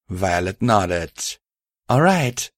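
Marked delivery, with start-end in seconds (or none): 1.9-2.5 lightly higher caring female voice; a partner checking gently. Acted. Violet speaking.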